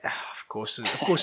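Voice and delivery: ordinary talk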